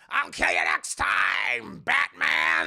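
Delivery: low, slow shouted threat; deep shouted Penguin threat; Penguin impression. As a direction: bellow it deep like a departing villain. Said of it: "And Danny DeVito's like".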